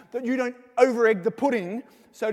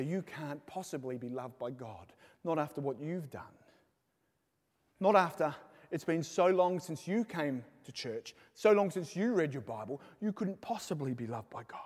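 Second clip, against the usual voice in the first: tempting devilish whisper; 4 semitones lower, 6 dB quieter than usual. Acted. Devil's whisper voiced by preacher.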